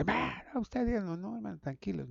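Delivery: light imitation of an indignant brother; mock offended listener. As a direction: voice a startled church member pushing back.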